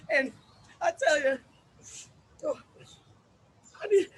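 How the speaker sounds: ordinary talk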